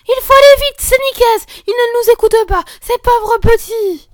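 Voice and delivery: put-on very high, loud children all talking at once; breathless alarm. Girls rushing to mother to save the hedgehogs, breathless. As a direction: burst in panicked and out of breath.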